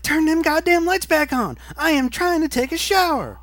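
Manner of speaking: imitating a woman yelling from her shower in the dark; woman screaming. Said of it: "And then she's like".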